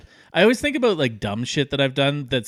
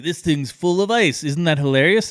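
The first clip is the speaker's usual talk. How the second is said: delighted; 6 semitones higher, 5 dB louder than usual. Retold or imitated. Drunk narrator delighted by ice.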